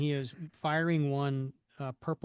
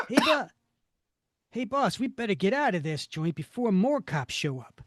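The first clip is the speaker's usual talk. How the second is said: henchman voice; 5 semitones higher, 4 dB louder than usual. Acted. Henchman urges leaving.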